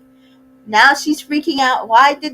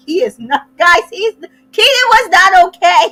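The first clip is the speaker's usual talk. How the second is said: rising, louder, pitched-up protest; exasperated raised voice cutting in; insistent outburst; 7 semitones higher, 6 dB louder than usual. Real, not acted. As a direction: push it up as you insist he wasn't okay. Insisting the cast member was not okay.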